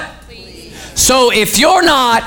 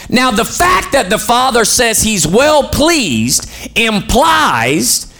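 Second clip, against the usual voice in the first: slow emphatic; 2 semitones higher, much slower than usual. Real, not acted. Preacher drawing out key point.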